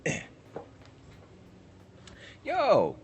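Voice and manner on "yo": loud high yo; shouting. Real, not acted. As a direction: call it out loud. Sudden shout.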